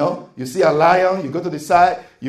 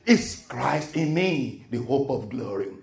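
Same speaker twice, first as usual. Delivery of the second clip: reverent answer; 5 semitones lower, 6 dB quieter than usual. Shown as a demonstration.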